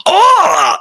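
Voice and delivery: high, loud mock pained yelp; Halo hunter hit cry. Imitating a Halo hunter hit by a shot, sharp yelp. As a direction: yelp like a monster getting shot. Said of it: "hunter things from Halo getting hit going".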